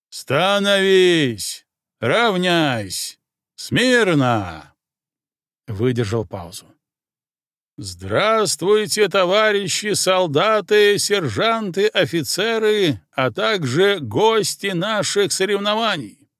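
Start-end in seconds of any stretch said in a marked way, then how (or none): 0.1-4.7 loud, slow, drawn-out parade-ground bark; barked drill commands. Acted. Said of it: "а затем гаркнул". 7.8-16.3 booming colonel address. Acted. Colonel greets the parade.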